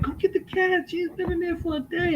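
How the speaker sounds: high mock nagging wife voice; squeaky put-on voice for the wife; high, whiny wife impression; imitating wife. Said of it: "My wife be like".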